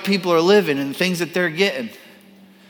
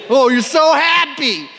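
resentful mockery; 8 semitones higher, 4 dB louder than usual. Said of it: "there's hatred".

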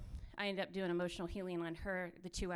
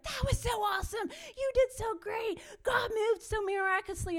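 much higher, bubbly mock praise; hammed-up high cheering from the translators; gushing praise; 13 semitones higher, 7 dB louder than usual. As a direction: gush like thrilled fans. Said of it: "our translators were like".

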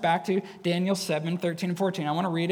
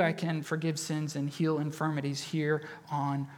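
quiet assurance; 5 semitones lower, 7 dB quieter than usual. Retold or imitated. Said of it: "He says".

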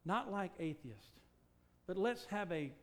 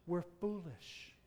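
ordinary talk, nothing marked

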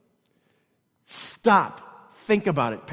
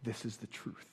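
much softer, reflective preaching; soft closing; 11 semitones lower, 12 dB quieter than usual. Acted. Pastor closing sermon before prayer, soft reflective. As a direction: wind down to a hush.

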